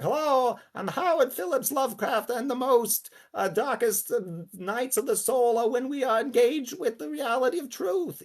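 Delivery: high, reedy, piping theatrical voice; Howard Phillips Lovecraft. Acted. Actor imitating the perfect simulacrum of Lovecraft, high and reedy. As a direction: declaim it in a very high, reedy register. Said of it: "his voice was very sort of high and reedy".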